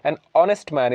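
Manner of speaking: "honest" begun on the vowel, no h; silent H. Shown as a demonstration. Teacher modelling the word for learners, silent h in "honest". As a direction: run "An" straight into the o of "honest". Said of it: "हॉनेस्ट नहीं पढ़ते".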